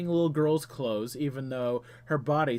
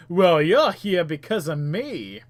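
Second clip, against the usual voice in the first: put-on chat voice; 3 semitones higher, 8 dB louder than usual. Reads player chat in put-on voice.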